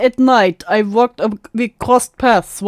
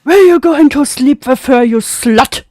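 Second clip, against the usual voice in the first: loud, raised-pitch hammed-up accusing; hammed-up confrontation, starting high and loud; mock accusation; 4 semitones higher, 5 dB louder than usual. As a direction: act out an outraged jealous accuser. Mock re-enactment of jealous accusation.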